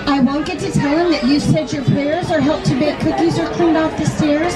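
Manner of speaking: ordinary delivery, nothing marked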